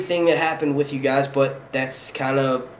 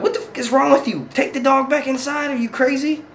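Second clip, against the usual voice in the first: high-pitched incredulous telling-off; retold incredulous scolding; 10 semitones higher than usual. Retelling scolding of dog's owner.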